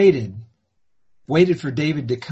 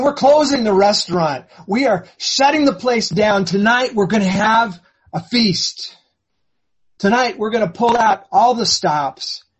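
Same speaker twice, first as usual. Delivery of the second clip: jubilant announcement; 5 semitones higher than usual. Acted. Father announces feast to staff.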